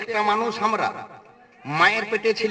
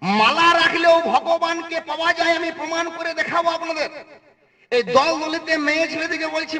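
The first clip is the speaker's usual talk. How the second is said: raised preaching; 6 semitones higher than usual. Acted. Preacher urges women in crowd to wear beads.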